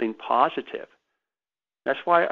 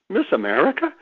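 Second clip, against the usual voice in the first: surprised; 7 semitones higher than usual. Retold or imitated. Disbelief at Miss America's chores.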